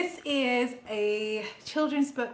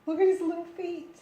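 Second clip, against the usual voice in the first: overwhelmed by cuteness; 7 semitones higher than usual. Real, not acted. Cute little feet.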